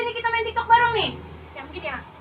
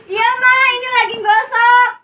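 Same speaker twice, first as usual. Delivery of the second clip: loud reply to mom; 4 semitones higher, 9 dB louder than usual. Acted. Kid answers mother loudly.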